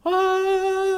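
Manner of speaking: a held high sung ah; mock heavenly chorus note. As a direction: sing out a choir-like ah of revelation. Lottery idea arrives.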